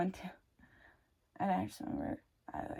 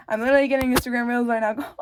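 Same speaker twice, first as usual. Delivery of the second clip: louder, fast, amused indignation; excited outburst; 3 semitones higher, 9 dB louder, faster than usual. Real, not acted. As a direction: blurt it out fast and loud. Phone interrupts her.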